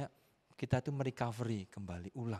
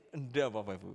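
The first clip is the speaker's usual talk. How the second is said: ordinary delivery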